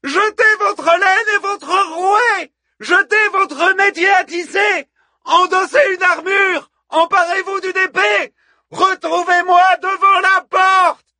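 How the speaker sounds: high, shouted orders to a crowd; yelling. A narrator's reading. Hippodamie orders the women to arm themselves, yelling. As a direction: fire off battle orders at full voice. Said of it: "hurla Hippodamie".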